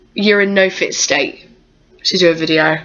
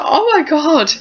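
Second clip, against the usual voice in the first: mock amazement; 9 semitones higher than usual. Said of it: "I was like".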